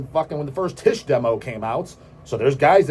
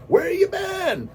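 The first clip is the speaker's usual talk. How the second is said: drawn-out high shout; much slower than usual. Pretend. Vlogger mocks a clueless viewer with a high, slow shout.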